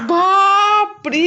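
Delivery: a drawn-out "বাপরে", loud and high, like a real fright; startled gasp. Real, not acted. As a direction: let out a stretched, startled cry. Startled by the pet.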